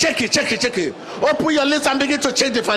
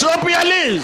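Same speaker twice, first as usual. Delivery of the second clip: shouting faith healer; 5 semitones higher than usual. Acted. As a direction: urge them on at full force. Preacher urging congregation, high.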